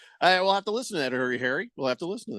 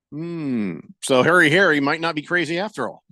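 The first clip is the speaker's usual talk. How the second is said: ordinary delivery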